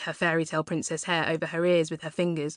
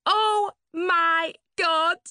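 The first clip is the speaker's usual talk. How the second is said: high, slow, drawn-out squawk; 12 semitones higher, 5 dB louder, much slower than usual. Acted. Shocked at Laurie's age, a high slow squawk. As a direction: squawk it out in disbelief. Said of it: "Suzanne squawked again".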